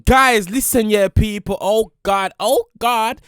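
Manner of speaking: loud, excited hype-man calls; hyped up. Real, not acted. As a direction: pump up the audience, loud and high. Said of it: "I'm so hyped up".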